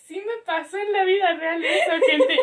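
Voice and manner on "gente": ordinary delivery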